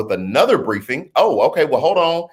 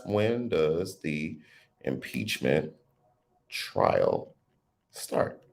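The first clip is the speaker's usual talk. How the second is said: trailing off; 9 semitones lower, 10 dB quieter, much slower than usual. Real, not acted. Reading a comment, trailing off.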